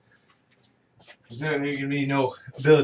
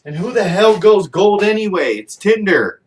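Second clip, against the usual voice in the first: loud, higher, exasperated rant; loud fed-up scoff; 3 semitones higher, 9 dB louder than usual. Real, not acted. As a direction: vent at the absurdity. Ranting about going gold.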